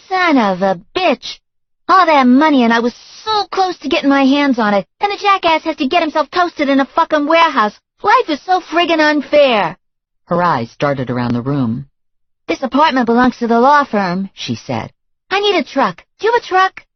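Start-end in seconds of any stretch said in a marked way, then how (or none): 0.1-9.8 cursing her lost fortune; furious girlfriend. A narrator's reading. Girlfriend ranting about the lost money, high and loud. 12.5-16.9 high and loud, brisk; already planning to loot the flat; scheming girlfriend. A narrator's reading. Girlfriend asking the narrator for a truck, high and brisk.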